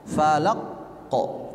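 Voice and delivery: "Falaq kok" with a held closure before the release; wrongly held qalqalah. Shown as a demonstration. Teacher showing the mistake, "Falaq kok" broken in two. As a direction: stop hard on "Falaq", then release "kok" late. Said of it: "jangan ditekan".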